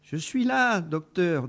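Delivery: high, small mouse voice; tiny voice. Acted. Little mouse answers the doctor, tiny high voice. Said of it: "dit une toute petite voix".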